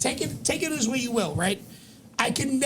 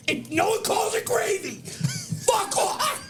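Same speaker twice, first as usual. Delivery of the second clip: fed-up shout; 8 semitones higher than usual. Real, not acted. Ranting to his co-hosts about a hack comic, shouting. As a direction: blow up at him. Angry rant about a comedian.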